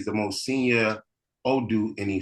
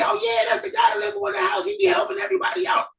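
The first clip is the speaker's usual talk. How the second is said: much higher, eager local voice; helpful townsfolk; 4 dB louder, faster than usual. Acted. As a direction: point the way, full of cheer. Townsfolk answer quickly.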